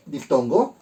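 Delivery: ordinary delivery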